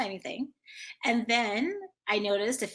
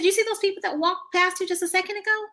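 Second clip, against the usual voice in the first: put-on high voice coaxing a child; acted high-pitched prompting; leading question; 11 semitones higher than usual. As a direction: quiz a little kid, pitched up.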